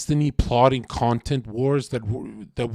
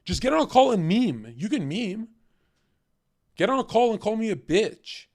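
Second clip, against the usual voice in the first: taunting; 8 semitones higher than usual. Real, not acted. Taunting chatter loudly.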